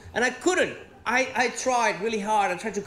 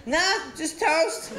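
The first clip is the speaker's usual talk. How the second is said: laconic Aussie answer; 6 semitones higher, slower than usual. Retold or imitated. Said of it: "friends ask what Australians eat".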